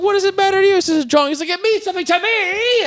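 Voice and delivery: high-pitched, over-the-top movie quote; high mock indignation; worked-up retold outburst. Acted argument from retold film.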